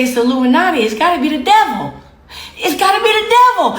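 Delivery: high, fast mocking accusation; hammed-up outcry; mock alarm. Mimicking suspicious critics of celebrities.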